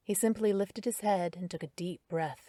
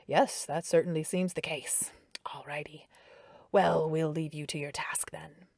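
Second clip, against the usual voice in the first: low and calm. A narrator's reading. The doctor coaxing Darren toward a vitals check, low and calm. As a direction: soothe him gently. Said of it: "voice low and calm".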